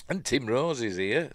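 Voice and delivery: normal talking voice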